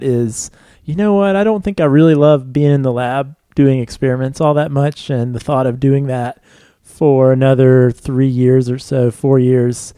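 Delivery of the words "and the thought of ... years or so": ordinary talk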